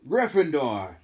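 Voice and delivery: hat finally announces Gryffindor; hat shouting. Acted. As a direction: call the house name out at last. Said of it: "When it finally shouted".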